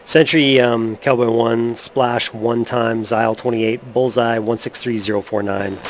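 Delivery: measured military radio report; pilot radio call. Acted. Player makes radio call.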